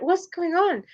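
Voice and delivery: high-pitched puzzled question; imitating your own confused reaction; retold bafflement. Retold bafflement at others' refusals.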